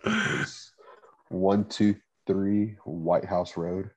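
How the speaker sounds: slow, drawn-out joking dictation; slow mock dictation. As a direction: spell it out slowly for the mic. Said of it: "say that into the mic a little closer".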